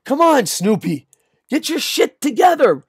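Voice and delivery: high-pitched, exasperated dog owner; angry scolding. Acted. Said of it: "you're still a little angry".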